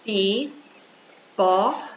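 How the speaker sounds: "Pipoca" dito pi... po... ca, com pausas; separado em sílabas. Shown as a demonstration. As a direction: split "Pipoca" into three slow syllables. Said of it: "Vamos separar?". (separando sílaba por sílaba, devagar)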